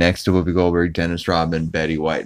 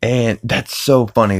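ordinary talk, nothing marked

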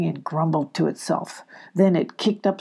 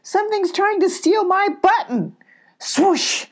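high, louder panicked cries; frightened cry; 12 semitones higher, 4 dB louder than usual. Acted. Abby crying out that something is stealing her button. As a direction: cry out in fear. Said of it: "cried Abby".

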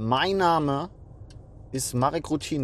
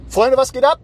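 high loud pumped-up greeting; hype greeting; 9 semitones higher, 9 dB louder than usual. Acted. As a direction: call it out like a hype host. Hyped channel greeting.

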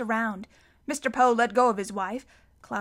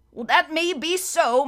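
higher, stiff official regret; apologetic banker; 5 semitones higher, 6 dB louder than usual. A narrator's reading. Mister Poe telling the children they must leave. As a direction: break bad news politely but firmly.

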